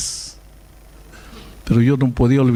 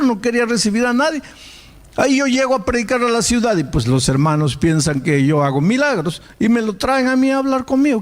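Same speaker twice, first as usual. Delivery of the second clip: high, driving sermon voice; impassioned preacher telling the story; preaching at full force; 9 semitones higher than usual. Acted. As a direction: preach it out to the congregation.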